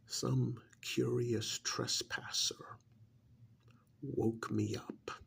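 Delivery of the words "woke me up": ordinary delivery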